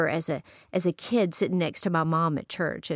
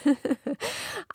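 laugh; 7 semitones higher, 5 dB quieter than usual. Real, not acted. Laughing at memory.

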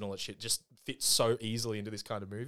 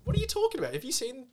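very high-pitched, squeaky disbelief; incredulous protest; 13 semitones higher, faster than usual. Real, not acted. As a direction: challenge them in a high, baffled voice. Mock quarrel over lead actor.

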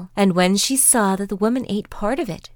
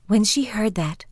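ordinary delivery, nothing marked

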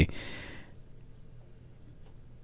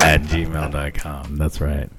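low, slow, soft seductive sign-off; mock-seductive low announcer voice; deep sensual voice; 9 semitones lower, 6 dB quieter, much slower than usual. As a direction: draw it out deep and smooth. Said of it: "talking in your deep voice".

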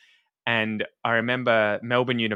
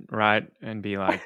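normal talking voice

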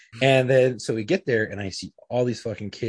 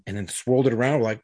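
ordinary delivery

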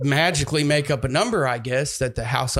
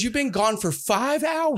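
higher, mildly exasperated retelling; retold disbelief, pitched up; incredulous brother; 8 semitones higher than usual. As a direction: imitate a brother who can't believe it. Brother astonished at his long absence.

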